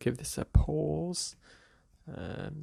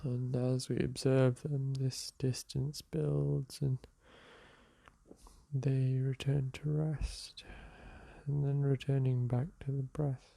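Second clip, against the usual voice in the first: calm, softer meditation guide voice; guided meditation; 3 dB quieter, slower than usual. Acted. Teacher guiding listeners through a seated meditation, calm and soft. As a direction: ease the listener into the breath.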